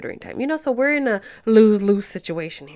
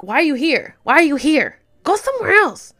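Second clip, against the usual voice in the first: loud, high shooing; yelling at fly; 6 semitones higher, 8 dB louder than usual. Real, not acted. Chasing off a fly in her room, loud and high. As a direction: shout the fly away.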